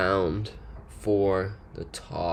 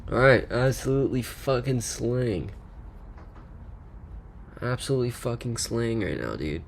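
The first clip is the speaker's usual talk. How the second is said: frat bro; 4 semitones higher than usual. Pretend. Continues bro hype.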